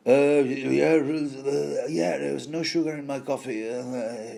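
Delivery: mumbled, stumbling sleepy babble; mock someone barely awake, words slurring into fillers; groggy, half-awake. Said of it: "coming from a dream".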